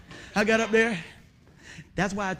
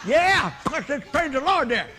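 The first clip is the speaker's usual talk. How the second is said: loud, high, nervous cheering; mock panicked praise; 6 semitones higher, 6 dB louder than usual. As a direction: bluff loudly through the panic. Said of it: "'Cause I'm panicking".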